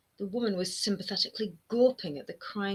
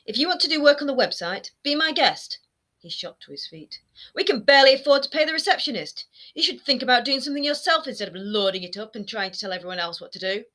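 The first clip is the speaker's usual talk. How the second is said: louder, higher angry rant; angry outburst; 6 semitones higher, 8 dB louder than usual. A narrator's reading. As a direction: rant at her, rising fury.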